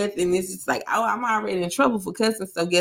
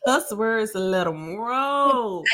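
slow, rolling, drawn-out words; relishing boast; much slower than usual. Real, not acted. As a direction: let each word roll out long. Relishing her skill at cussing.

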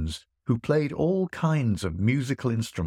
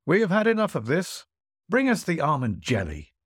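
high, lordly call for the next dish; 7 semitones higher than usual. Acted. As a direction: summon the jelly with a flourish. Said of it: "the host cried out".

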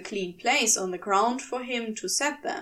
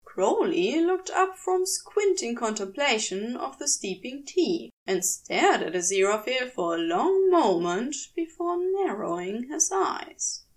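plain reading voice

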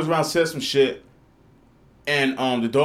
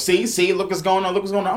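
higher, louder scolding voice; scolding lady; 6 semitones higher, 3 dB louder than usual. Retold or imitated. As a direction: scold like a nosy neighbor.

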